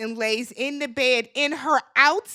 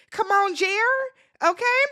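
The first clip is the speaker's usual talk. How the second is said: pleading; 7 semitones higher, 3 dB louder than usual. Real, not acted. Pleading with Jeremiah.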